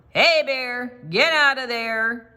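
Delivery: loud call into the forest; hey bear, called out loud and high; yelled. A narrator's reading. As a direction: shout to scare off a bear. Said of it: "I yelled".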